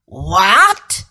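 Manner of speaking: restrained roar of disbelief, starting low; a low, indignant outburst; witch's roar. A narrator's reading. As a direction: roar it as the witch, held in check. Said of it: "roared the witch".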